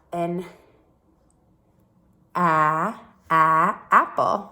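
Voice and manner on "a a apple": "a" said short as in "apple", louder; short a drill. Shown as a demonstration. Teacher sounding out apple's first vowel for children. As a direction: punch the short "a, a" before "apple". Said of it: "the A says the a sound in apple".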